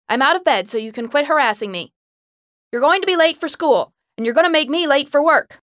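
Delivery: loud, high scolding; annoyed shouting. A narrator's reading. Mother scolds daughter about being late, loud shouting. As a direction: scold her at full volume.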